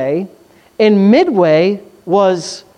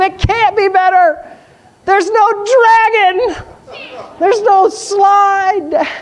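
put-on whiny kid disciples; 11 semitones higher, slower than usual. Disciples whine like a child.

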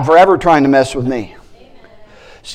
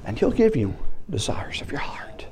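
soft near-whisper; hushed reassurance; whispered promise; 2 semitones lower, 9 dB quieter than usual. Real, not acted. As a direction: lean in and whisper it.

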